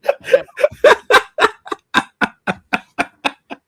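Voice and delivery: airy high-pitched laugh. Real, not acted. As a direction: burst into a breathy laugh. Reacts to lunch argument.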